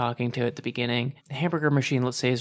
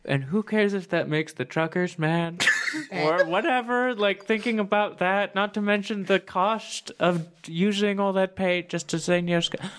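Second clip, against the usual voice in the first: character voice; 6 semitones higher than usual. Acted. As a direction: ham it up as Rusty. GM continues character voice.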